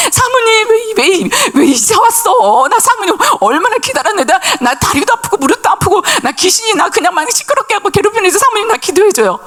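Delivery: high, lightly voiced old lady begging; a hint of a needy old woman whining; elderly woman pleading with the pastor's wife, voiced high.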